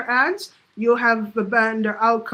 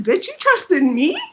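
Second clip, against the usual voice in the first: higher, louder and slow; teasing a friend in disbelief, higher and slow; 6 semitones higher, 4 dB louder, slower than usual. Retold or imitated. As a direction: drag it out in mock shock. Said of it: "And I said".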